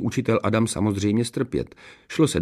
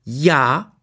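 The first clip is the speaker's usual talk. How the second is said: high, affronted retort; snapping back; 6 semitones higher, 4 dB louder than usual. A narrator's reading. Princess snapping at her sister, affronted. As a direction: fire it back, indignant. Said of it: "utrhla se na ní Ksenie".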